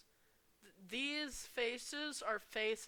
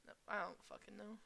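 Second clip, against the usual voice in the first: ordinary talk, nothing marked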